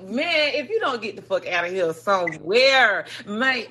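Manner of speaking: normal talking voice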